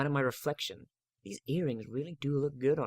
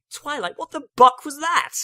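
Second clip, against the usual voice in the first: put-on much higher, loud young dragon voice; Spike shocked; 13 semitones higher, 11 dB louder than usual. A narrator's reading. Young dragon yells at Twilight after the theft, high and loud. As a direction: squawk it out in alarm. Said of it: "asked Spike".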